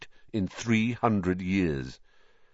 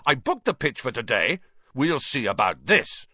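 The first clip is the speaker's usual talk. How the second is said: indignant; 4 semitones higher than usual. A narrator's reading. Wood angry.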